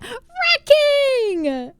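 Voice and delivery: high, loud, drawn-out hype call; excited shout-out. Real, not acted. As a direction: belt out the name like a hype announcer. Hyped shout-out to a viewer.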